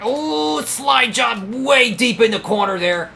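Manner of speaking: sudden high yelp then slowed, strained commentary; startled cry. Real, not acted. Racer startled by a slide job, high yelp. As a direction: caught off guard by a slide job. Near wreck while racing.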